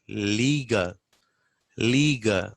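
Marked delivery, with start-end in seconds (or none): none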